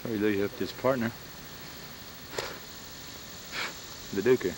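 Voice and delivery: talking while eating seeds; words round a mouthful of seeds; cracking sunflower seeds. Real, not acted. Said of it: "Pardon me, I'm eating sunflower seeds".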